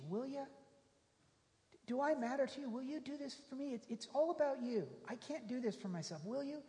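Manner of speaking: a hint of the leper's desperate plea; pleading leper. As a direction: beg quietly for help. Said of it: "what does Jesus say".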